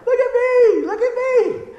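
put-on very high, loud childish squeal; little kid on a swing. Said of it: "like the little kids on that swing".

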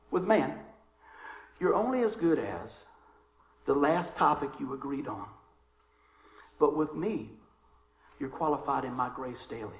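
hushed, lightly voiced divine message; the Lord. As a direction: let each phrase settle quietly, with long pauses. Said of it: "this is what the Lord shared".

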